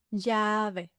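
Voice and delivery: LLAVE with a long, level "yaa"; slow "Llave", first vowel held; drawn-out demonstration. Shown as a demonstration. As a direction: stretch "Llave" slowly for learners. Word repeated slowly.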